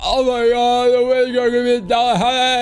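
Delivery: high, drawn-out mock groan of dread; mock whining. Imitating people dreading their workout, whining. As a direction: whine it out slowly like a reluctant quitter.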